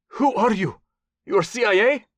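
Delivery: put-on high, accented, startled questions; alarmed warlord. Captive warlord questions his interrogator, startled and high. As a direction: ask as if suddenly realizing.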